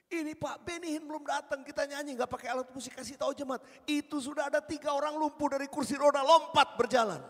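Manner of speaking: high, urgent relayed news, building to a shout; excited report. Retold or imitated. Committee head running up to tell the preacher of healings, excitedly.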